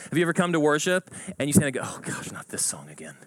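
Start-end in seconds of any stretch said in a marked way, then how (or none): 1.8-3.2 whispered, exasperated groan; soft, low whisper of dread; grumbling aside. Acted. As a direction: mutter it under your breath in church. Imagined grumble in worship.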